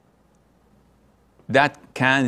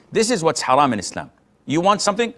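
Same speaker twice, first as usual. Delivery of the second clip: usual speaking voice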